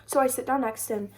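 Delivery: ordinary delivery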